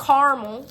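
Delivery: caramel squashed into CAR-mul, said loudly; two-syllable caramel. Shown as a demonstration. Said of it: "Not caramel".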